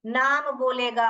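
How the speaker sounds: ordinary talk, nothing marked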